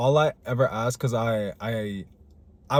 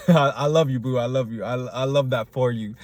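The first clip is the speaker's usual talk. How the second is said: ordinary talk, nothing marked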